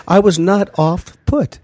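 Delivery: high, prim English lady; British lady voice. Acted. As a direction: mimic a refined British lady.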